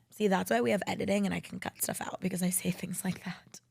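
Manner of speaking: whispered aside; sheepish whispered aside to the viewers. Real, not acted. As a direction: confide it under your breath. Aside about editing mistakes.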